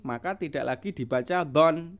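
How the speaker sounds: ordinary delivery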